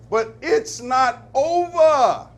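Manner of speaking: loud, drawn-out and high, leaning on over; emphatic declaration. Acted. Radio preacher to his listeners, loud and slow. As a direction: stretch it out like a preacher pounding the pulpit. Preacher stressing fight continues.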